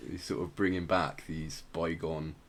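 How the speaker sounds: usual speaking voice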